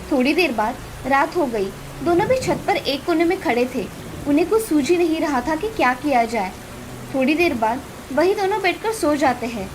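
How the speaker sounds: usual speaking voice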